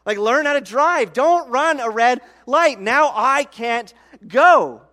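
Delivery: high, loud furious yelling at the driver blocking the lane; angry driver yelling. Retold or imitated. Driver blocked at a green light, yelling in anger. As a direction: let it rip at the idiot in the intersection. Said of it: "I've honked and I've said".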